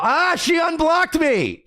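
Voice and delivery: high, drawn-out exclamation; astonished outcry. Real, not acted. Streamer finding out she unblocked him, astonished outcry. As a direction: cry it out in sudden amazement.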